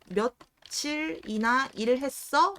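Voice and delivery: 며칠이나 일했어 drawn out slowly; slow example. Shown as a demonstration. Teacher reading an example sentence to students, slow. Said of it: "이렇게 만약에 해볼게요".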